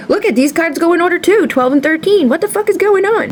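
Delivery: high, amazed reaction to the cards; startled disbelief at the order; surprised. Real, not acted. Reader reacting to cards falling in order, surprised.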